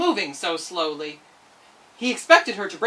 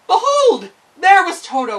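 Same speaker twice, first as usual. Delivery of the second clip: loud, high triumphant reveal; dramatic reveal; 10 semitones higher, 12 dB louder than usual. A narrator's reading. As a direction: announce the surprise. Narrator's big reveal.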